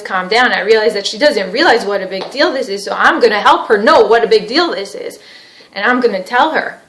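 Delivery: loud, emphatic insistence. Acted. Husband insists wife must know it's serious, intense. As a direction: hammer the point home, worked up. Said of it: "tell her louder with more intensity".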